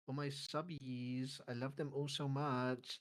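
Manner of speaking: soft low coo for the fans; cooing softly. Pretend. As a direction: coo sweetly, slowly. Cooing at subscribers.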